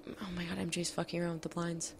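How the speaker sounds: muttered under the breath; quiet aside. Real, not acted. Aside about roommate at blinds.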